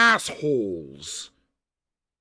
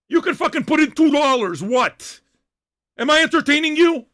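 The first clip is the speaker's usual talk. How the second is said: higher, raised challenge; indignant yelling; 6 semitones higher, 4 dB louder than usual. Real, not acted. Host challenging viewers to pay two dollars, indignant yelling. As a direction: demand an answer, voice up. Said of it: "why I'm yelling right now".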